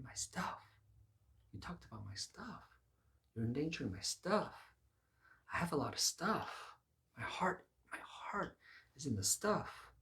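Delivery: hammed-up breathy whimper, voice rising; mock-wounded, half-whispered pleading; sad. Preacher as the rich ruler losing his possessions, sad. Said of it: "he starts being sad".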